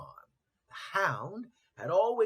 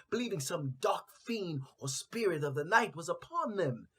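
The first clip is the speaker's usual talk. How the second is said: plain reading voice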